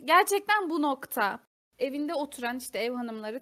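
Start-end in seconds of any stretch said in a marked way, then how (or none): 0.0-1.4 louder, slow, pointed question; emphatic auntie address. Acted. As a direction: spell it out loudly to the aunties. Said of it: "Bakın şu an bu oluyor".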